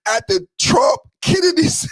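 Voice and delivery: high, cracking-up read of the name; excited squeal. Real, not acted. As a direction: squeal the name through laughter. Reading Trump's post, cracking up.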